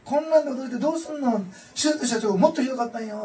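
high, loud cheerful Osaka-ben; Osaka dialect. Retold or imitated. Mother brushing off son's shock after surgery, loud Osaka-ben. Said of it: "大阪弁ですね".

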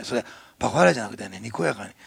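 ordinary delivery

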